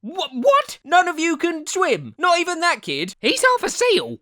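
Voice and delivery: high, drawn-out incredulous questioning; stunned disbelief. Acted. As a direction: play an astonished teacher, climbing to a squeal. Acted teacher stunned nobody can swim.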